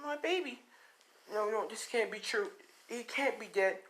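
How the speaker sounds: high, soft acted disbelief; distraught mother. Mother refusing the cop's news, distraught. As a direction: plead in a small high voice as a grieving mother. Mother told her child is dead.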